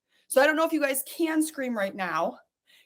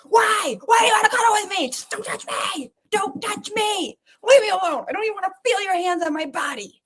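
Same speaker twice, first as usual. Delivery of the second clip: high, shrill-free mock yell at husband; retold angry outburst; 7 semitones higher, 3 dB louder, faster than usual. Wife replaying shouting at her husband, mock angry yell. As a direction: fire it back like the old fight. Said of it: "And I was so mad".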